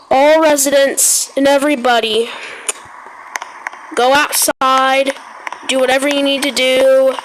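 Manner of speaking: news-anchor alert read, steady and formal; mock emergency broadcast. Gamer announcing a pretend disaster to the server, announcer voice.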